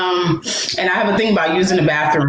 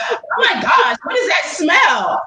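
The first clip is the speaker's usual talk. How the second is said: disgusted stranger; 8 semitones higher than usual. Retold or imitated. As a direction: shriek it like a stranger smelling something awful. Stranger reacting to the smell.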